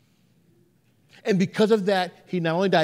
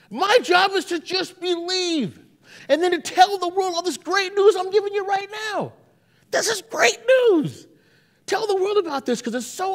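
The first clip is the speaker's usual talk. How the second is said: excited preaching; 11 semitones higher than usual. Real, not acted. Preacher building to exclaim good news.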